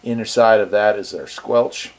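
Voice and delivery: normal talking voice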